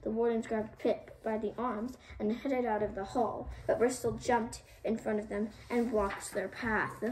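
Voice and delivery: ordinary delivery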